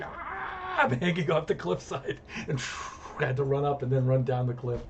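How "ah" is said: much higher mock scream of pain; a hammed-up yelp. Stung on the cliff, a much higher mock scream. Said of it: "and I'm like".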